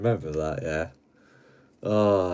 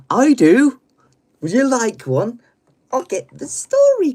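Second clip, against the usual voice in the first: very high, loud excited offer; high, loud mock excitement; hammed-up eager storyteller; 15 dB louder than usual. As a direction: squeal it with theatrical glee.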